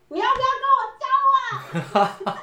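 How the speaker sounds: high, loud shouted question out of nowhere; loud blurted shout. Retold or imitated. Boy shouting his confession question at his crush, loud shout. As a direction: yell the question so the whole class hears. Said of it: "就超大声的喊说".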